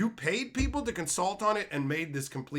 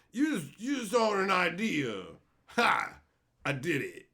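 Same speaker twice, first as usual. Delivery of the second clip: light mock gloat; mocking smug writer; 3 semitones higher, 4 dB louder, slower than usual. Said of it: "Who would even say that".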